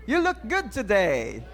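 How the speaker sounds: slow, lightly put-on American accent. Shown as a demonstration. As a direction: greet in a drawn-out American accent. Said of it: "At yun po ay American accent". (Amerikanong punto)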